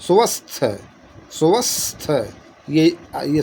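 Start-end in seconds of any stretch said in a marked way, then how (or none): 0.0-2.1 स्वस्थ drawn out as "su-vasth", higher pitch, rising; demonstrating स्वस्थ. Shown as a demonstration. Teacher sounding out the word for students, high and deliberate. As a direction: sound out स्वस्थ slowly with a held "s" and no y. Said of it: "स्वास्थ्य कहिए या स्वस्थ".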